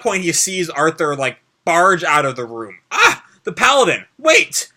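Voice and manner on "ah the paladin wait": high, loud call after someone leaving. Acted. Ryuji shouts after the paladin walking off, loud and high. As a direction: shout to stop him before he goes.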